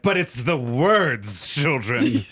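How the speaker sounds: very slow, drawn-out catchphrase; self-mocking teacher plea. Teacher explaining to misbehaving children, slow mock plea. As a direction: stretch each word for the class.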